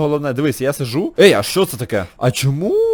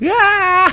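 high sharp yell of a crash; real startled scream; pain yelp; 4 dB louder than usual. Real, not acted. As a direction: cry out as if hit. Crash on the slope.

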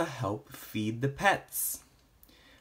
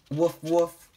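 louder, higher puppy woofs; read-aloud puppy barking; dog bark; 4 semitones higher, 6 dB louder than usual. Acted. Dog barks in picture book.

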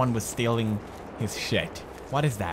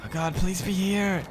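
desperate plea; 7 semitones higher than usual. Real, not acted. Desperate search under threat.

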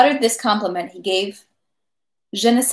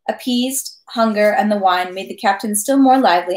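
ordinary delivery

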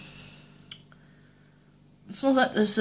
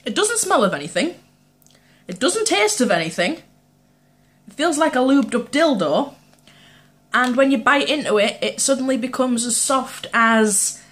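talking while eating sweet; chewing; 4 semitones higher, 4 dB louder than usual. Real, not acted. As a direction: mouth partly full. Seen 3: Bites dark sweet at 4.5, then holds it and talks chewing.

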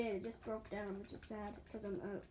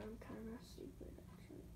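whispered aside; 5 semitones lower, 20 dB quieter, faster than usual. Real, not acted. Muttered aside to self.

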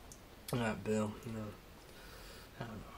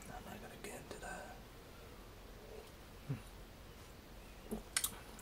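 whispered under the breath, much softer; 19 dB quieter, much faster than usual. Real, not acted. Whispering to himself that he'll drop the subject. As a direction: breathe it like a secret aside.

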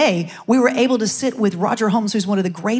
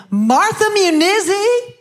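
Donnie's greeting; 5 semitones higher, slower than usual. Retold or imitated. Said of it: "he sees me and he said".

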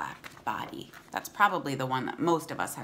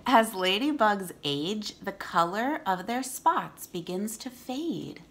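clear, steady read-aloud voice; storybook reading for kids; reading to children; 5 semitones higher, 7 dB louder, slower than usual. Acted.

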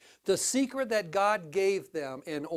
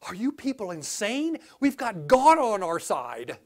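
Joshua incredulous; 3 dB louder than usual. Retold or imitated. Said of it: "Joshua and Caleb was like".

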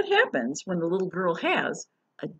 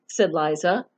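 lively picture-book reading voice; reading to children; 2 semitones higher, 6 dB louder than usual. Acted. Teacher reading a storybook to children, lively. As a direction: read it bright and expressive for kids.

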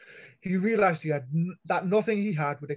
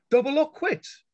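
firm, steady repeat, still raised; 10 semitones higher, 6 dB louder than usual. A narrator's reading. Callie repeating her demand firmly to the boss. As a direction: say it again, leaving no room. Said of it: "Callie repeated firmly".

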